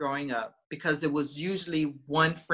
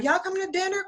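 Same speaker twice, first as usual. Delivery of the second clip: high sing-song call to dinner; calling out sweetly; 13 semitones higher, faster than usual. Retold or imitated. Twin calling the boyfriends to dinner, high sing-song. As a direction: call them in to eat, bright and high.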